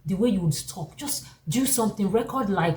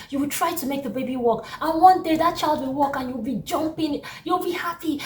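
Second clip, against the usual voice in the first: ordinary talk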